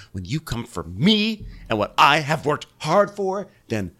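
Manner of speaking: high, indignant rant; going off. Retold or imitated. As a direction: go off on them, hitting key words hard. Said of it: "Candace is still going off".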